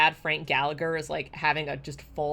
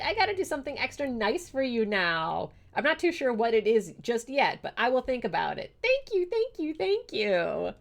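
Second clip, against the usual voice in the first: high, giddy thanks; excited gratitude; 7 semitones higher than usual. Real, not acted. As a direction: keep gushing at the viewer in a higher pitch. Still thanking the generous viewer.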